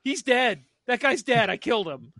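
freaking out. Retold or imitated. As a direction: blurt it out as if you just killed a man. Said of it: "freaking out".

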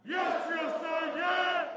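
slow, high group shout; shouted reply. Real, not acted. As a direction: shout it back in unison. Recruits answer in unison.

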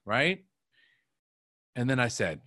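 ordinary talk

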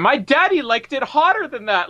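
high, excited mock girl; seventeen-year-old girl. Girl giving old man Niven a bath, high and eager. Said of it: "And her going".

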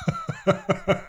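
high-pitched, soft laugh. Real, not acted. Laughs at cat Tony mid-intro.

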